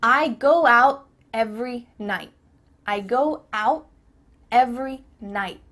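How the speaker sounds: "out" and "night" stopped before the t releases; cut-off T. Shown as a demonstration. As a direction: hold back final t in "out", "night". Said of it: "cut off the".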